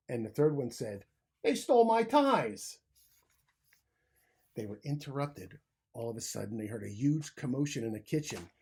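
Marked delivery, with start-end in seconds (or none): 1.4-2.8 high, loud squeaky complaint; upset little mouse. A narrator's reading. The tieless third mouse cries out high and loud. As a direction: whine it like a wronged mouse.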